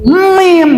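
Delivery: MIM high, with a long hummed first m; lengthened m. Shown as a demonstration. Teacher demonstrates the nasal hold, lengthened m. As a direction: hum into the m of mim. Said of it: "Kenapa dipanjangkan m-nya".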